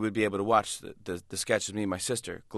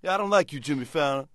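morning zoo DJ; 4 semitones higher than usual. Retold or imitated. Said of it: "He's like".

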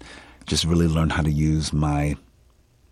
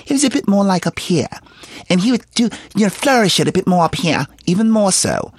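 much higher pitch, louder and flourished; Bayard Rustin's high pitch; 5 dB louder than usual. Shown as a demonstration. Actor showing an interviewer the man's heightened voice, high-pitched and loud. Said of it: "it was even higher pitch".

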